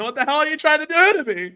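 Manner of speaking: high, loud exasperated cry; mock outrage. Real, not acted. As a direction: whine in exaggerated protest.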